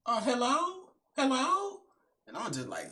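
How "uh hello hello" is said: high, soft questioning voice; mimicking a puzzled, high-pitched hello; imitated phone check. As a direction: check if the caller is still there. Girl on silent phone call.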